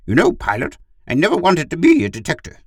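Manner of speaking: high, loud dog character; carefree talking dog. Acted. Gur confesses to his pilot, carefree dog voice.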